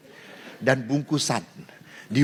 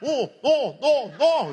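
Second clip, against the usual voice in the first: put-on child whimpering; 9 semitones higher than usual. Child whimpering about hard chicken.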